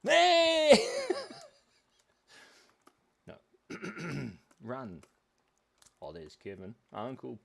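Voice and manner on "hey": sudden high shouted Hey into a laugh; sound effect yell. Pretend. Streamer making sound effects instead of talking, a cartoon yell. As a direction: blast a silly cartoon yell. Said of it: "I'm going to just make sound effects".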